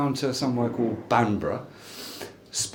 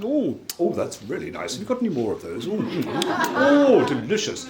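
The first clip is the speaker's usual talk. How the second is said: a light, pleased gorilla voiceover with a few high oh's; lightly voiced gorilla savouring a treat; 5 semitones higher than usual. Speech man imitates a TV gorilla voiceover, lightly voiced. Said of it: "the voiceover would go".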